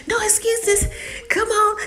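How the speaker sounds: high, excited taunt on the run; playful goading. Retold or imitated. Charlie running ahead of her brother, playful goading. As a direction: urge them on, high and teasing. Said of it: "Charlie's laughing and running".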